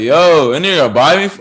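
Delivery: loud, high excited exclamation; mad excited. Retold or imitated. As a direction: burst out with excitement. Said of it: "I was mad excited".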